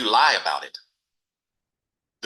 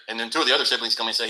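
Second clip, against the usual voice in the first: normal talking voice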